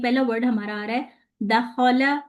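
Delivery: ordinary talk, nothing marked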